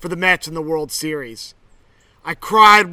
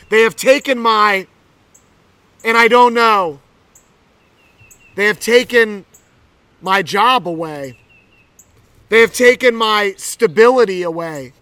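impassioned lament; 3 semitones higher, 5 dB louder than usual. Acted. Pastor voicing Mary's grief, building.

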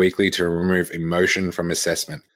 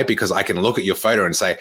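usual speaking voice

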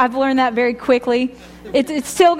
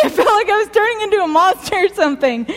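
laughing it off, fast and higher; 6 semitones higher, faster than usual. Real, not acted. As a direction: rush through it with a laugh.

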